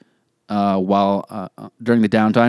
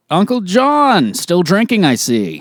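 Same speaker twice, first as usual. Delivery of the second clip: mocking relative; 8 semitones higher, 3 dB louder, slower than usual. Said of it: "it'd probably be like".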